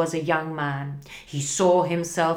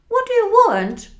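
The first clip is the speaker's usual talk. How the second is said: frightened; 7 dB louder, faster than usual. Acted. Scrooge afraid of ghost.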